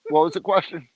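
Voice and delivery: talking while chewing; words through a bite of food; mouth full. Real, not acted. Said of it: "Sorry, I'm eating".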